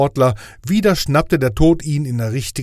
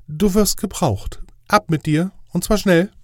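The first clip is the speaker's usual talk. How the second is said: higher, official angel voice; fussy inspector angel; 7 semitones higher than usual. Acted. Inspector angel grading Death's test, higher official voice. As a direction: play the strict inspector angel, pitched up.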